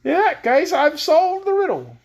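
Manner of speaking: high, slow gleeful cry. Acted. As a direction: crow out the answer with glee. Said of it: "cried Scraps, dancing with glee".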